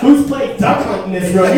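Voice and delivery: normal talking voice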